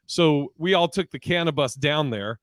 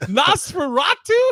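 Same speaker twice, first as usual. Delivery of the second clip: mock disbelief; 13 semitones higher, 6 dB louder, slower than usual. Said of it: "You go".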